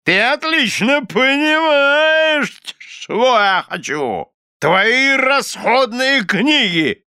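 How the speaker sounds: very high, loud, drawn-out bluster; imperious anger. A narrator's reading. Husband demanding housekeeping books, angrily imperious. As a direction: declaim the demand slowly at full pitch.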